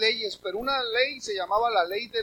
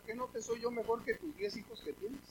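much softer, teasing imitation of the husband; hushed mock husband; 15 dB quieter than usual. Preacher mocking a husband asking his wife, hushed and teasing. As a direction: drop almost to a murmur, teasing. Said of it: "le puede decir a su esposa".

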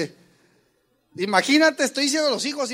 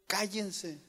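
softer order; 8 dB quieter than usual. Shown as a demonstration. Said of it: "les digo".

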